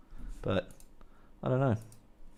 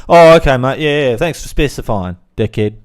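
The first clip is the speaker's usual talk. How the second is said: loud mock thanks; sarcastic heckler; 11 dB louder than usual. Heckler answering his podcast pick, loud sarcasm. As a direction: thank him loudly with open sarcasm.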